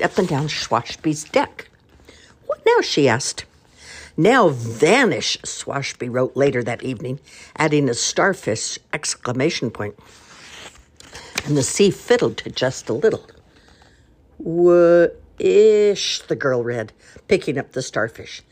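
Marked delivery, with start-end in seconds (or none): 2.5-2.8 put-on bright high child's question; high piping girl asking. Girl asks eagerly. 4.2-5.4 stern command. Acted. Grumpy message in sand. 14.4-16.2 long held eerie word; sea's voice drawn out; magical drawn-out word. Acted.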